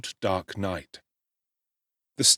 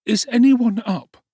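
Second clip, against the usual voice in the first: put-on high, louder, slow boy's question; young Oliver; 10 dB louder than usual. A narrator's reading. Oliver asking Dick at dawn, high anxious boy. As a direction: ask anxiously as a runaway boy.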